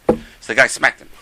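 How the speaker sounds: ordinary delivery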